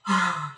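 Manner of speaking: audible gasp of air, then release; acted breathing sigh; demonstrated first breath. Shown as a demonstration. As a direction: take a newborn's first breath. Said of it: "he starts breathing".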